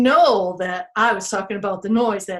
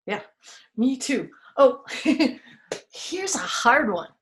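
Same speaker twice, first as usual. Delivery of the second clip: usual speaking voice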